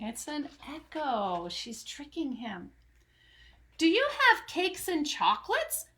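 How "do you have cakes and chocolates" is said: greedy eager cry. Acted. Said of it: "the highway robber cried".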